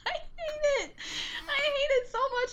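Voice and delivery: high squealing laughter; can't stop laughing; laughing hysterically. Real, not acted. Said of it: "Your face. You did the turtle face".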